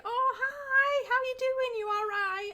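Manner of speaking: high sing-out hello, imitating mum's friend; imitated high, chirpy small talk; over-friendly greeting. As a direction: gush like you know them well. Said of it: "this is really friendly".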